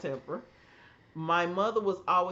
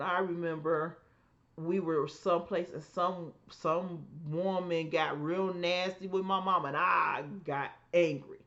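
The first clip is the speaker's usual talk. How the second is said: ordinary delivery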